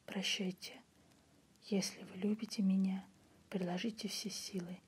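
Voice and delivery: plain reading voice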